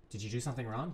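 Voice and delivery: soft, low accusing question; quiet suspicious question. Pretend. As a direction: ask it softly, suspicious. First take, quiet.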